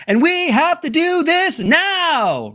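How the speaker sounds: high, slow, rising campaign-speech pitch; politician's rising inflection. Retold or imitated. Host mocking politicians, pitching his voice up high and slow. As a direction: swoop the voice up like a politician begging for applause. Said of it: "they sort of go up in their inflection".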